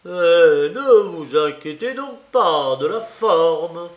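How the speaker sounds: loud, teasing brush-off; swelling, derisive reassurance; sardonic. A narrator's reading. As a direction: wave it away mockingly, loudly. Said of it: "de son ton narquois".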